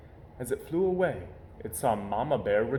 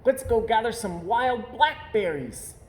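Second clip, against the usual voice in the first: cub voice; 10 semitones higher than usual. Acted. Cub Sister voiced in story.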